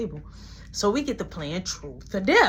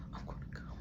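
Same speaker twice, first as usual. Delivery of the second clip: whisper; 15 dB quieter, faster than usual. Retold or imitated. Her whispering mid-scene.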